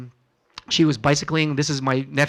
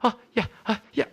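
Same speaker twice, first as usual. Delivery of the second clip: mock out of breath; 6 semitones higher, faster than usual. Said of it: "I'll be huffing and puffing".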